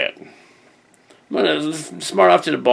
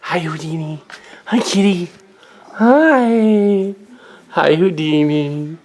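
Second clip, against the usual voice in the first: high cooing voice for a cat; put-on pet-greeting voice; pet talk; 4 semitones higher, slower than usual. Real, not acted. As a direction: coo a drawn-out hello to the kitty.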